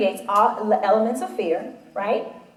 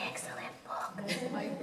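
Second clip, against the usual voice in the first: whispered aside; 17 dB quieter, much slower than usual. Real, not acted. Quiet aside agreeing with listener.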